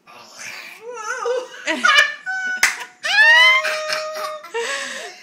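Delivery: hearty laugh. Real, not acted. Laughing at baby's sour face.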